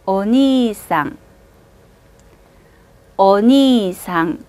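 오니상 with 니 held long, slow; 오니상, draw 니 into 이 smoothly; long vowel. Shown as a demonstration. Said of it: "따라서 읽어보세요". (오니상 니를 길게 끌어서)

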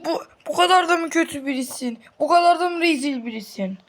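high indignant scolding; outraged. Pretend. Scolding the villain, high outraged disbelief.

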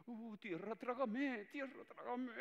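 high squeaky mortified voice; mortified employees. Acted. Caught employees wishing to vanish, high mortified squeak.

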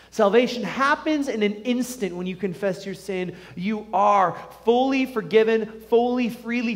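usual speaking voice

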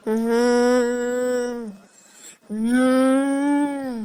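eerie drawn-out moans and calls; feigned ghost possession. Pretend. Mother faking possession to make her family obey, ghostly wailing. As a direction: wail slowly like someone pretending to be possessed. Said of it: "దయ్యం పట్టినట్టు చేస్తేనే".

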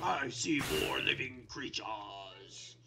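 slow, low villain voice; voiced monster. Player voicing the wither spotting prey, low monster voice. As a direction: speak as a prowling monster, low and drawn out. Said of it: "He's like".

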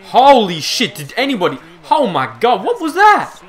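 loud, rising to a very high what was that; shocked outburst. Real, not acted. Gamer reacting to an absurd rebound, shocked rising shriek. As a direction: build from a shout to a shriek.

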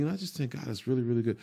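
soft, fast murmur to yourself; quiet self-affirmation. Retold or imitated. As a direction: murmur the promise under your breath. Self-talk looking at ceiling.

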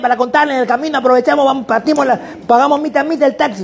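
put-on high, rushed, overeager young salesman; eager salesman. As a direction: pitch the shared taxi like a pushy go-getter. Voicing the hyperactive student César.

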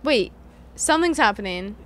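ordinary talk, nothing marked

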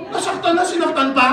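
reacting with loud, high shock; shocked disbelief. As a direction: gasp at the cruelty. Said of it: "Ay, sabi ko".

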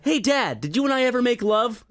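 high, loud mock phone call; hammed-up questioning son; son calling Dad. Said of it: "All of a sudden you call your dad".

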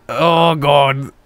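usual speaking voice

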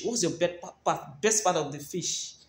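tripping over the words; stammering. Real, not acted. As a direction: stumble on the first sounds. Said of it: "I'm already stammering because I'm hungry".

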